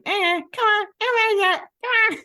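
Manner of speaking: very high, loud imitation of a toddler. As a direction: mimic a tiny child tugging a horse along. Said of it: "eighteen or twenty months old, toddling".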